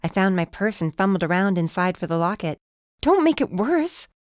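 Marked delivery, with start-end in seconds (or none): 3.0-4.1 pleading. A narrator's reading. Darcy pleading with Ethan.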